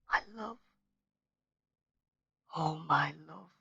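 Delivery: soft, hushed lament over her body; whispered. A narrator's reading. Son kneeling by his dead mother, softly whispering. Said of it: "he whispered again and again".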